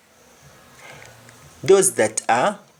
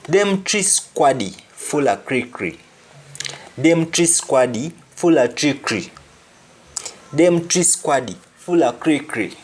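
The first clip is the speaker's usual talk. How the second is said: "Them tree squaddy" with "dem" and "tree" for three, Jamaican patois; 3 semitones higher than usual. Shown as a demonstration. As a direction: model the patois line, dropping every th. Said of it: "Those three officers are full of trickery".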